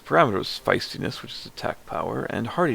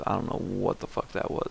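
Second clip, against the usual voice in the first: usual speaking voice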